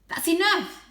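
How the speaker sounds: sharp, loud snap; a loud sharp retort; snapped. A narrator's reading. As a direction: cut her off hard. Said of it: "snapped Holly".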